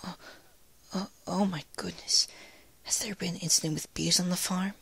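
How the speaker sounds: high, breathy timid whisper; timid Fluttershy. A narrator's reading. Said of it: "Fluttershy stood and gently brushed".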